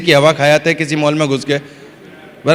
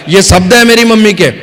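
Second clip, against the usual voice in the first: usual speaking voice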